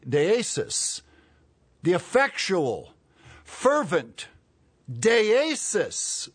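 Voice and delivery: loud, high, word-by-word proclamation; emphatic preaching. Real, not acted. Preacher drives home the Greek word, emphatic preaching. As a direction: hammer each word separately with pauses.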